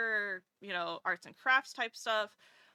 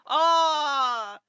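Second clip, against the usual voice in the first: Scottish man; 10 dB louder than usual. Retold or imitated. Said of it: "And he goes".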